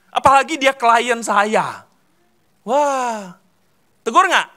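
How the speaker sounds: high, loud fretting about rebuking; worked-up worry. Retold or imitated. Preacher voicing a churchgoer afraid to rebuke, worked up. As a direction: voice the anxious doubts with energy.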